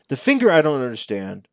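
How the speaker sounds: ordinary delivery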